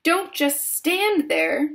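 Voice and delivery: high, drawn-out scolding cry; slow, high-pitched frantic demand; screamed order. A narrator's reading. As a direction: scream at a useless husband. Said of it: "screamed at Mister Gloop".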